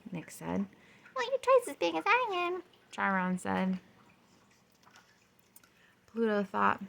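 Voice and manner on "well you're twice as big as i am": high, small moon voice. A narrator's reading. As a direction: pipe up like a tiny moon. Charon the moon speaks.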